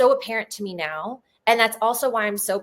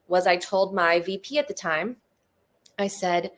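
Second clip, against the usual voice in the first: ordinary delivery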